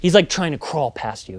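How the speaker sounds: ordinary talk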